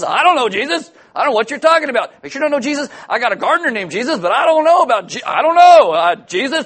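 high, loud, rising to a frantic shout; panicked denial. Acted. Peter frantically denying he knows Jesus when questioned, shouting in panic. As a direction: blurt it out in a scared rush, cracking into a yell.

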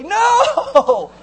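high, loud drawn-out NO; big theatrical shout; emphatic shouted no. Acted. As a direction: bellow it in protest. Rejects the nice-story reading.

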